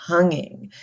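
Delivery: breathy whisper of tonguing; whispered aside to the class. Shown as a demonstration. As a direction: whisper the word. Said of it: "whispered word".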